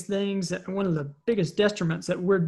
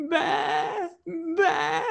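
high, tearful mock sheep bleat; whimpery kid's sheep noise; crying child bleating; 11 semitones higher than usual. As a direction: imitate a little boy baa-ing through tears. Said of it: "he's kinda crying. He's like".

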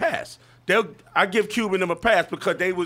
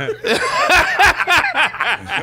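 loud high laugh; 14 semitones higher, 7 dB louder than usual. Real, not acted. Big laugh at the joke.

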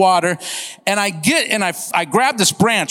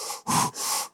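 voiceless puffs of air; imitated shivery breaths; 12 dB quieter than usual. Hunter with his toe in icy water, mock cold puffs. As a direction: blow out short breaths as if freezing.

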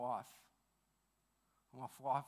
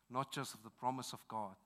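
ordinary talk, nothing marked

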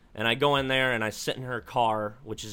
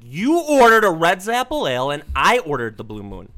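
exasperated protest; 4 semitones higher, 9 dB louder than usual. Retold or imitated. Arguing over beer order.

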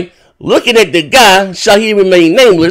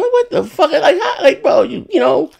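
laughing disbelief; 11 semitones higher than usual. Real, not acted.

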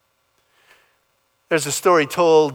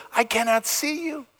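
put-on little boy; 11 semitones higher, 3 dB quieter than usual. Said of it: "the little boy says".

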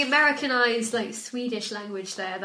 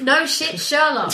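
sarcastic; 5 semitones higher, 7 dB louder, slower than usual. Real, not acted. Mocking an obvious leap.

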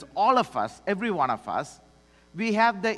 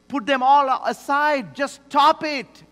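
raised, louder voice; emphatic preacher quoting a command; forceful command; 5 semitones higher, 6 dB louder than usual. Retold or imitated. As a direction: issue a blunt order, high and loud.

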